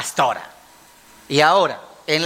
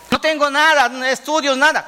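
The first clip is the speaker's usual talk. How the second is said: higher-pitched mock protest; objecting protester; 8 semitones higher than usual. Preacher voices a man without studies, protesting. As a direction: plead an excuse in a raised pitch.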